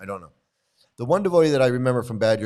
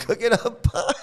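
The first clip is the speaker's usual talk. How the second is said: laughing; 13 semitones higher than usual. Real, not acted. Laughing at the memory.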